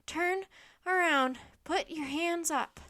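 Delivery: breathless. A narrator's reading. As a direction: urge it in short bursts. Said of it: "Jack said breathlessly".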